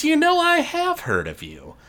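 high, smooth villain greeting; Skyval voice. Acted. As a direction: purr it like a sly host.

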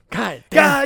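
high, loud frustrated cry; exasperated outburst. Real, not acted. About his headphones failing again: high exasperated outburst. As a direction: blurt it out in frustration.

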